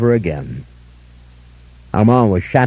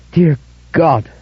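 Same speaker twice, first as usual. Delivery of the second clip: higher, shocked cry of awe; stunned exclamation; 6 semitones higher than usual. A narrator's reading. David seeing the veil, cries out in awe. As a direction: gasp it out in awe.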